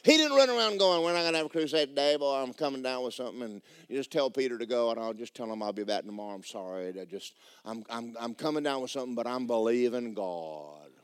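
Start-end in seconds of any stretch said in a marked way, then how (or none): 1.0-10.9 softer, lower, self-pitying excuse; mock sickly whine. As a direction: whimper as if coming down with something. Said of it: "imagine Jesus talking like that".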